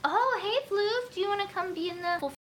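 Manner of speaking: high coaxing pet voice. Real, not acted. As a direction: coo at the cat. Greeting the cat.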